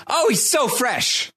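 high, drawn-out admiring cry; imitated gushing. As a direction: gush it in a high, stretched-out voice. Acting out Akbar's admiring reaction.

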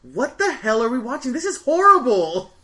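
mock outrage in a high put-on voice; Desiree's disgust. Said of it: "Desiree just goes".

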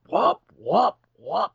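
rising imitated whoop cries; mock whoop calls. Telling a friend how the boat crew came, with hooting whoop calls. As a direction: call out whoop whoop like the boat guys.

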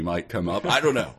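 usual speaking voice

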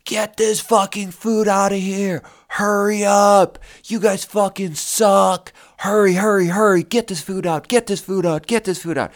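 high, frantic mock yelling at servers; screaming kitchen manager. Kitchen manager screaming at servers to get food out, high frantic mock yell. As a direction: bark orders like a furious cook slamming the pass. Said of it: "he would just scream and be like".

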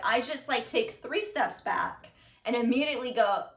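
normal talking voice

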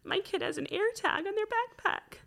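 high, tearful voice; real crying, pitch climbing way up; crying while talking. Real, not acted. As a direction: push the words out through tears. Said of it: "I'm gonna start crying".

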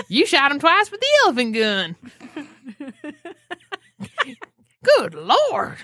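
high, twangy hillbilly quote. Acted. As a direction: lay on a big hillbilly twang. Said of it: "thinking of [name] and Tremors".

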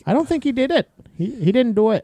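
high, hammed-up Wisconsin accent. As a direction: play up a thick Wisconsin accent. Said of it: "Answer in your best Wisconsin accent".

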